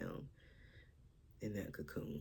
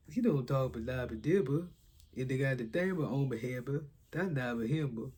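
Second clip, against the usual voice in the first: loud garbled cartoon mumble-talk; cartoon character's babbling speech; Marshmallow; 6 dB louder than usual. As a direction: jumble the words with extra b sounds. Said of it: "Is that Marshmallow?".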